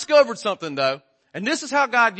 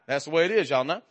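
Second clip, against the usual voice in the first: normal talking voice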